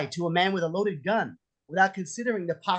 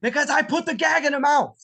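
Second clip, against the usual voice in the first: gleeful reveal; 8 semitones higher, 4 dB louder than usual. Acted. Triumphant reveal.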